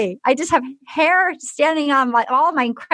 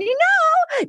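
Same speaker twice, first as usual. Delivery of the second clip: much higher, swooning mock gush; gushing high repeat. Woman declaring he is everything to her, high gushing. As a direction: lift it way up, as if lovestruck.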